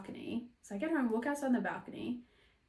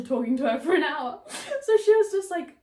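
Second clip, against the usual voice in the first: laughing; 7 semitones higher, 10 dB louder than usual. Real, not acted. Laughing at own story.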